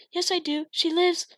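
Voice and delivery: put-on high child voice, insisting; young boy pushing back; child protesting. A narrator's reading. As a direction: plead the kid's case.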